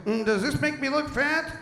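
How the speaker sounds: put-on coy voice; joking fashion question. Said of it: "I thought it'd be funny".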